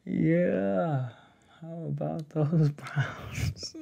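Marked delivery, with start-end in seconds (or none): 1.6-3.8 slow breathy whisper of the joke line; whispered repeat. Real, not acted. Awkward on a call, repeating the joke as a slow whisper. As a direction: mutter it again under your breath, slowly.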